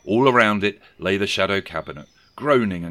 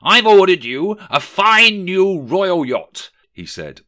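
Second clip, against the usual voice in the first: magnanimous Scrooge; 11 semitones higher, 9 dB louder than usual. A narrator's reading. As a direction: roll out the gift grandly. Scrooge's gift to clerk.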